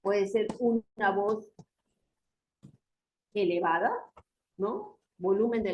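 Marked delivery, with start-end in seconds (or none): none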